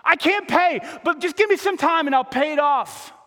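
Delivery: high, begging plea to the king; desperate servant on his knees; pleading servant. Acted. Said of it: "starts to plead with the king".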